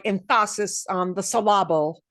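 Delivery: emphasis as em-FAH-sis, syllable as sil-LAH-ble; misplaced stress. Shown as a demonstration. Teacher joking about putting the right emphasis, misplaced stress. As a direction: wrongly punch the middle of emphasis and syllable.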